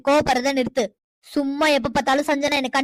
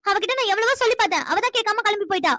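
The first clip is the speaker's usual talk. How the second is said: defensive protest; 6 semitones higher than usual. Acted. Sister defends herself to angry brother.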